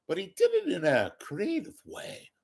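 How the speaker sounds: ordinary talk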